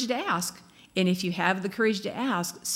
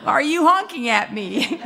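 louder, laughing mock protest; laughing mock indignation; 6 semitones higher, 7 dB louder than usual. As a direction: play hurt while laughing at yourself. Mock driver honked at.

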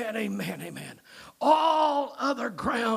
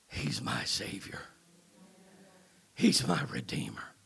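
whispered, much softer and lower; hushed adoration; 11 semitones lower, 7 dB quieter than usual. Real, not acted. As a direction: breathe it out in humble awe. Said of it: "I say it with humble adoration".

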